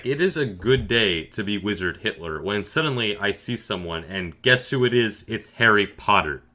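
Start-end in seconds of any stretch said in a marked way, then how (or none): none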